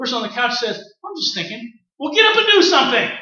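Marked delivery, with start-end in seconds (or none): 0.8-1.7 quiet reply. Retold or imitated. Person on couch answers. 2.0-3.1 scolding. Retold or imitated. Scolding someone relaxing.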